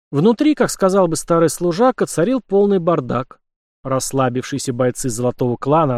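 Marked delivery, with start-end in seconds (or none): none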